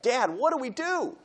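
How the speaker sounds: put-on high, slow, pleading child's voice; pleading kid. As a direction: play the upset kid begging dad for help. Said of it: "And the kids say".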